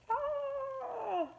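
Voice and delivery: high, held cheerful ah; a big happy gasp; delighted gasp. Acted. Teacher gasping with joy as the fish escape, delighted ah.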